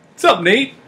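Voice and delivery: high-pitched, loud mock greeting; comically casual squeaky hello; carefree friend's greeting. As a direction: imitate a pal breezily rolling in. Carefree friend strolls in, imitated.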